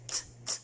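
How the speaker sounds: "T" as soft voiceless clicks of the tongue; T letter sound. Shown as a demonstration. Teacher showing what T says, "T" tapped voicelessly. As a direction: tap out "T" with no vowel. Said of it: "The T says T".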